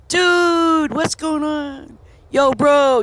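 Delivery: high, drawn-out holler to buddies; hollered greeting. Acted. Greeting friends as dude and bro, exuberant call. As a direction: call out to your pals with gusto.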